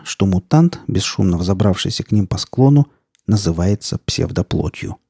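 usual speaking voice

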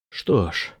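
low, soft professor; resigned professor. A narrator's reading. Professor behind the narrator weighs options, low and soft. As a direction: say it low, almost to yourself.

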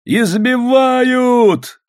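high, long-drawn shout of alarm; alarmed cry. A narrator's reading. Someone in the beaten crowd, high drawn-out cry.

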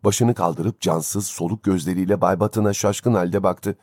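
usual speaking voice

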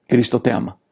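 ordinary talk

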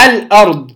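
الأرض with the ل clearly sounded before the hamza; إظهار اللام. Shown as a demonstration. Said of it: "أظهرت". (الأرض بإظهار اللام الساكنة)

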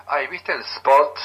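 drawn-out, affected "¿viste el spot?" with a rising end; mock trendy speaker. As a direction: playfully imitate someone showing off English words. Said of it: "me dice".

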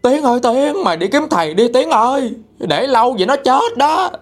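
high-pitched alarmed calling; neighbour crying out. Retold or imitated. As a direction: imitate a panicked neighbour calling for help. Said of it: "cô Lan trong xóm mới la lên là". (giả giọng la làng hốt hoảng)

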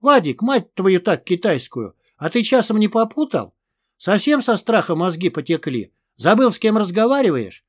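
ordinary delivery

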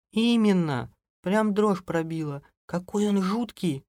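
high, slow, spooked voice; frightened wife. A narrator's reading. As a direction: confess the scare in a high voice. (испуганная жена, высоким голосом)

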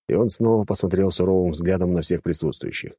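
plain reading voice

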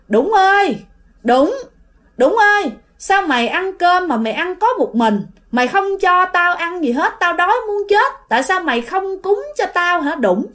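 high, piercing ghostly call; high-pitched eerie neighbour calling out; loud neighbour's voice. Retold or imitated. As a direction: call out shrilly, demanding to be fed. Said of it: "giọng nói rất là lớn nha".

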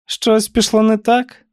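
slow, high probing question; prying aunt. A narrator's reading. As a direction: press the niece slowly, pitched high. Aunt presses niece with questions. (повільний високий допитливий голос тітки)

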